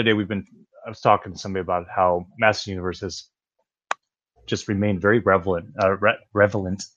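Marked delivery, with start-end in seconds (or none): 5.0-6.8 "relevant" stumbled over as "revelant"; "relevant" mispronounced. Real, not acted. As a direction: swap the l and v in "relevant". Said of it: "re-, whatever".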